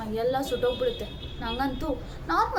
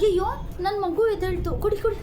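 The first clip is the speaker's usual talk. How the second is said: high, louder flustered fussing; fussing over baby; 7 semitones higher, 3 dB louder than usual. Acted. Young mother fusses as her baby wakes, high and flustered. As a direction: rush to the waking baby.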